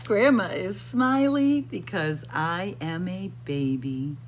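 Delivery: ordinary delivery, nothing marked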